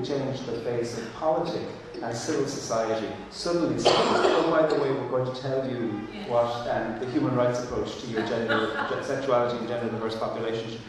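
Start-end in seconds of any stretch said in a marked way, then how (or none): none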